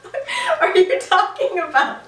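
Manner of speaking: high, laughing disbelief; half-shriek through laughter; laughing outrage. Retold or imitated. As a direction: dreamer baffled by the alien's claim, laughing. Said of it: "I was like".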